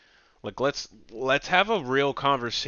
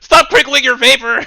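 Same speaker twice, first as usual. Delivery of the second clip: loud, high mocking yell; hammed-up shrieking protest; mock shout; 10 semitones higher, 13 dB louder than usual. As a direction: bellow it like a fussy heckler. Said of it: "he's like".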